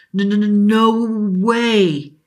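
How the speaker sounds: put-on a boy who cannot believe his eyes; stunned stammer. Boy watching mist become a genie, stammering in shock.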